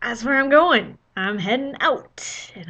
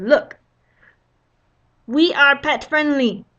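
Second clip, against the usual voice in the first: louder, slow and pointed; triumphant; 4 semitones higher, 6 dB louder, slower than usual. Retold or imitated. Guest to clerk showing website, pointed and loud. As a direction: slap the proof down.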